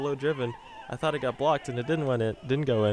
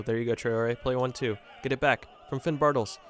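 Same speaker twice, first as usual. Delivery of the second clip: ordinary talk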